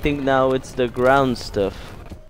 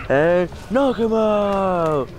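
high, long drawn-out call; excited urging; 8 semitones higher, much slower than usual. Real, not acted. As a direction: stretch the knockout call up high. Calling for a knockout in game.

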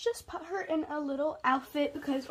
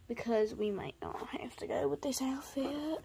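whisper; 6 dB quieter than usual. Real, not acted. Aside while dressing toy.

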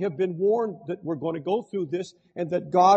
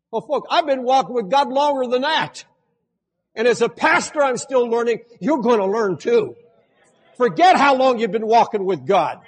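preaching; 5 semitones higher, 6 dB louder than usual. Acted. Rising sermon passage.

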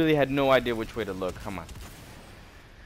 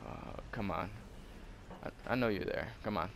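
ordinary talk

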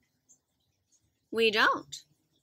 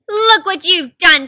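angry accusation; 4 semitones higher, 11 dB louder, slower than usual. Acted. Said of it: "said Bunny".

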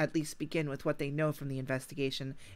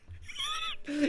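high evil laugh. Acted. Villain laugh in letter.

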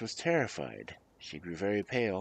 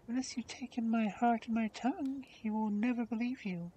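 high-pitched princess voice; gentle princess; 14 semitones higher, 4 dB quieter than usual. Acted.

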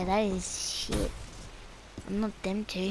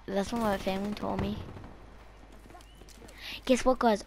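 ordinary talk

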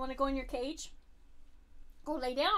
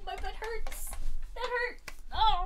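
usual speaking voice

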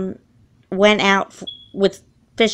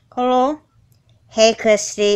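ordinary talk

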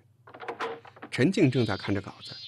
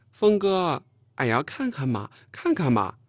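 mimicking a girl's cutesy wheedling; louder, coquettish pleading with a high opening call; 5 semitones higher, 5 dB louder, slower than usual. A narrator's reading. Colleague imitating the girl to coax him, cutesy wheedle. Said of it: "模仿着晴儿说话的情调".

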